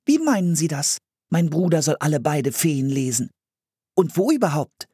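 put-on high, inquisitive girl voice; high-pitched child quizzing an adult; questioning girl. A narrator's reading. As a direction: demand an explanation as a puzzled sister. Sagte sie und wandte sich an den Mann.

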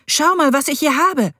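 high and loud, showing off a treasure; eager pride. A narrator's reading. Boy showing his stepmother the antler, eager and proud. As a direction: hold up your prize for her.

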